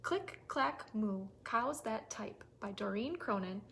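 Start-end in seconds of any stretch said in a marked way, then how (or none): none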